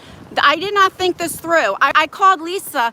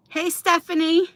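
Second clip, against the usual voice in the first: ordinary talk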